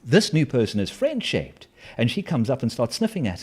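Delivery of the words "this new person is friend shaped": a hint of a happy dog, high on oh; lightly voiced happy dog; dog's voice. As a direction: voice the goofy dog's delight. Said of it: "and goes".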